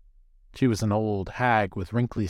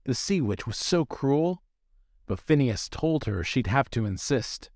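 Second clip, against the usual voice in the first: plain reading voice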